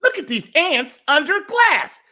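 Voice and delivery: high-pitched, mockingly fascinated alien; amused alien. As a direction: coo over the ants like a bored tourist. Bored alien watching ant farm.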